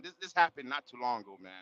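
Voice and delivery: ordinary talk, nothing marked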